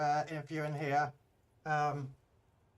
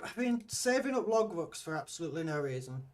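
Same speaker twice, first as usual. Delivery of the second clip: usual speaking voice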